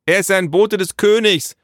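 high, loud holler; calling into the house. A narrator's reading. Naru passing the news to father inside, calling out. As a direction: call it through to another room. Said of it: "rief Naru ins Haus hinein".

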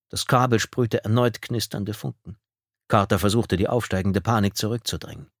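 ordinary delivery

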